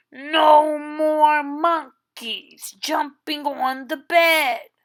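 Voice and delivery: a touch firmer, like a doctor losing patience; stern doctor. Acted. Doctor's repeated warning.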